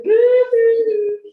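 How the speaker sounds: high, loud mock boo-hoo blubbering; mock crying. Midwife mimicking a weepy mum on day three, mock sobbing. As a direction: ham up a new mum bursting into tears, voice jumping high. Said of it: "You'll burst into tears".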